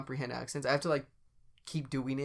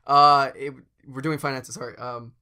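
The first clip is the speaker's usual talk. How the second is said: flustered businessman; 7 dB louder than usual. Pretend. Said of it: "give me like a businessman".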